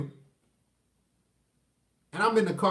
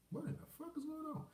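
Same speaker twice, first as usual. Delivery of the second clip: hushed disbelief; 4 semitones higher, 22 dB quieter than usual. Retold or imitated. She still hasn't come.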